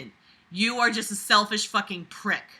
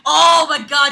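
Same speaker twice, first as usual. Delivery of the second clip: loud high exclamation; outraged shout; 6 semitones higher, 12 dB louder than usual. Real, not acted. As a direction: blurt it in outrage. Outraged at text.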